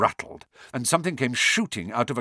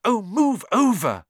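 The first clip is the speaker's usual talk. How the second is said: high, louder impatient snarl; 12 semitones higher, 5 dB louder than usual. A narrator's reading. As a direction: snarl at him to move. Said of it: "Hermione snarled".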